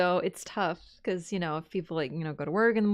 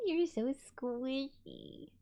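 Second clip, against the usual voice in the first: drawn-out cooing, softer; tender slow coo to the purring cat; 5 semitones higher, 5 dB quieter, much slower than usual. Real, not acted. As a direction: melt over the cat's softness.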